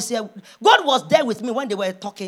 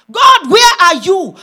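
loud, high, drawn-out plea; crying out to God; 7 semitones higher, 10 dB louder, slower than usual. Acted. Preacher calling on God, loud demanding cry. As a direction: shout it up to heaven, stretching the words.

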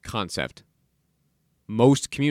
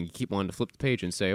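ordinary talk

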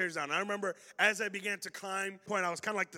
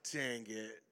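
muttered dang it; 8 semitones lower, 6 dB quieter than usual. Retold or imitated. Realizing a blunder, self-reproach.

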